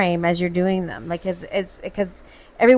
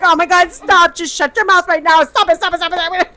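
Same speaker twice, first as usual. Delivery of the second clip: higher, mock-desperate begging; frantic pleading; 6 semitones higher, 4 dB louder than usual. Wife retelling begging husband to stop, frantic pleading. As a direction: beg in comic panic, words tumbling out.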